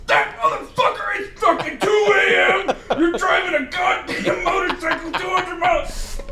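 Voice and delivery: high, slow, drawn-out outrage; mock road rage. Retelling dad cursing a passing motorcycle at night, mock fury. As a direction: act out an indignant driver's rant, stretching the words.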